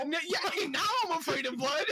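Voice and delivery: high, laughing through the words; laughing disbelief. Real, not acted. Host agreeing with co-host about creepy texts, high-pitched laughing disbelief. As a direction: exclaim it while cracking up.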